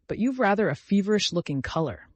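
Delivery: "feverish" leaned on, pointed; stress on feverish. A narrator's reading. Husband to wife, stressing "feverish". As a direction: weigh the word "feverish" with meaning. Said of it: "laying stress on the word feverish".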